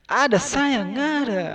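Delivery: high, cooing ada sayang, nggak ada; sweet reply. Retold or imitated. Voice behind the door answering sweetly, high. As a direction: croon the contradiction. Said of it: "Bilang lagi dia".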